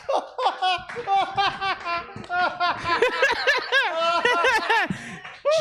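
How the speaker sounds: drawn-out, rising high cry; long high wail. Pretend. Comedian's impression, a long high wail. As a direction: hold a long wail upward. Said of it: "this is".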